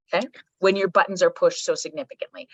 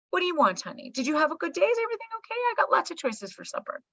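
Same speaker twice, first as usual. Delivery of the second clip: put-on high, soft, honeyed fussing over a child; gushingly sweet host; 10 semitones higher, 4 dB quieter than usual. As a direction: coo indulgently at a visiting kid.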